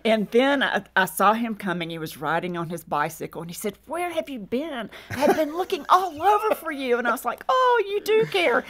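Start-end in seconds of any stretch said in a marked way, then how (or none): 3.9-7.0 higher-pitched imitation of a worried husband; exasperated husband. Husband finding his wife after searching, exasperated. 7.5-8.6 much higher, drawn-out mock swoon; slow, high and touched, hammed up; gushing relief. Wife to searching husband, gushing relief. Retold wife's sudden relief.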